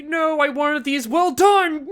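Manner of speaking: high, loud hammed-up wail; mock outrage. Complaining the cooked mice were wanted well done, mock-outraged yell. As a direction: yell it in comic despair.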